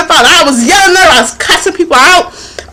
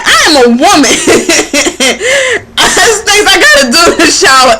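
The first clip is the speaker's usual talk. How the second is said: laughing protest; 3 semitones higher, faster than usual. Retold or imitated. Woman protesting the father's seven-minute shower rule, laughing. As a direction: insist through laughter. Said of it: "I'm like".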